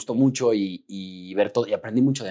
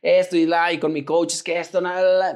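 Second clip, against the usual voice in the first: higher, louder mock whine; whiny complaining; 5 semitones higher, 4 dB louder than usual. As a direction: imitate yourself griping about a scene. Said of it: "cuando yo empecé a chillar de". (quejido chillón, imitándose a sí mismo)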